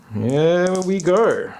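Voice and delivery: hammy character voice. Pretend. Rolling dice for treasure, hammy.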